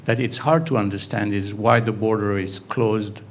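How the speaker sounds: normal talking voice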